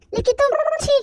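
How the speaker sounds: put-on a girl's disgusted little chi. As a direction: sneer at him in disgust. Heroine scorns mafia.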